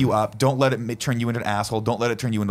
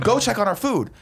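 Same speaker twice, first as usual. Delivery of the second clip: retold order; 10 semitones higher than usual. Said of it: "I'm like".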